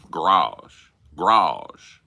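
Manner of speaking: "garage" as one loud syllable, "grazh". Shown as a demonstration. Spelling it out for chat, "garage" said as "grazh". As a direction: drop the first vowel of "garage", punched out loud. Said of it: "G R A G G E".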